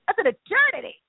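high, hammed-up amazement; mock amazement. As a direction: play it mock-astonished. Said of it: "And you think".

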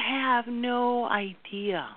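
slow, high stretched stress on have no idea; emphatic. Real, not acted. Astrologer to viewers, stretching have no idea slowly. Stressing how little we know.

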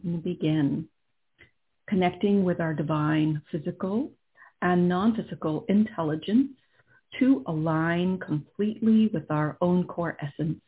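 calm and unhurried, guiding inward; right in your ear. Acted. Leads a guided meditation.

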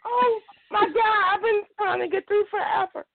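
high, stretched-out, overwhelmed disbelief; real starstruck joy, pitch way up; ecstatic caller. Real, not acted. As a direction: gasp it out high, overjoyed to get through. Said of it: "so ecstatic right now".

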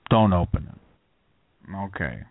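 low, halting movie-dad warning impression; low voice, long pauses as the warning escalates; Dustin Hoffman. Dad catching his kid opening the ice cream, in a Hoffman impression. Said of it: "Dustin Hoffman tonight".

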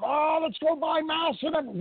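high, sneering mock quote; mocking panic. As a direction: parody a panicked shopper.

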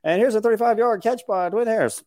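high announcer voice, hamming the call; mock play-by-play call. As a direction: call the catch like a radio announcer. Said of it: "After he'd say".

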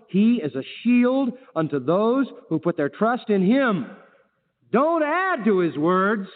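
high, drawn-out pulpit proclamation; full-force preaching. Acted. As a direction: hammer out each word of the verse.

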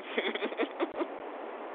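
fading silent laugh; soft breathy laugh. Real, not acted. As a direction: breathe out a quiet giggle. Trailing laugh after the money joke.

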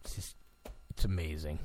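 very quiet, low murmur; barely voiced, deep and soft; hushed. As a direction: say it under your breath, low. Hushed aside before shouted catchphrase.

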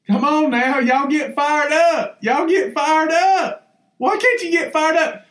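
fired-up sermon call; rousing preacher. Real, not acted. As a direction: whip the crowd up, preacher style.

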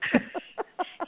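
airy little chuckle; quiet amused laugh; soft breathy laugh. Real, not acted. Laughing at the lazy lab.

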